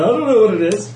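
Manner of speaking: high-pitched mock doctors' shrug; baffled doctors. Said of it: "all the doctors went".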